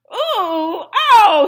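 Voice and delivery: loud high gasp of disgust; gasp at bad breath. A narrator's reading. Mouse dentist gasping inside the fox's mouth. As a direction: recoil with an ooh, oh. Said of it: "he gasped".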